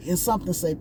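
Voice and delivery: normal talking voice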